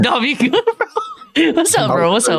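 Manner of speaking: high-pitched, giggling check-in; real laughing. Real, not acted. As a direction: tease a drowsy friend through laughter. Teasing sleepy friend while laughing.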